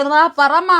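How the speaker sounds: loud, high prompt to mother; insistent high-pitched call; calling mother over. Real, not acted. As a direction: raise your voice to summon her for the sign-off. Calls mother over to sign off.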